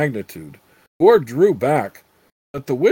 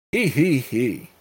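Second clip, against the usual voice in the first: witch cackle. Acted. Witch laughs in story.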